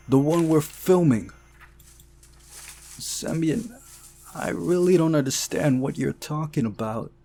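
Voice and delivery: agitated argument. Acted. Narrator and club boy arguing about the film. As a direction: press him hard for answers.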